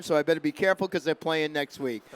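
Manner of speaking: normal talking voice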